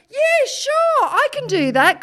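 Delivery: very high, loud, bright-eyed agreement; retold over-keen phone voice; overeager yes. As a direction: squeal out an instant, overconfident yes. Feigned confidence to TV producer.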